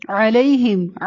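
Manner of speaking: علیہم opening with ع from mid-throat; throaty ain. Shown as a demonstration. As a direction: squeeze the first sound of علیہم from the throat. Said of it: "عین کی آواز حلق سے نکلے گی". (علیہم کا عین حلق سے)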